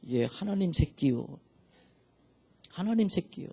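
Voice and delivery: soft, meek, barely daring to answer; tiny shrinking voice. Retold or imitated. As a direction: voice crawling back into the throat. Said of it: "목이 기어 들어가는 소리처럼, 목이만한 소리로요".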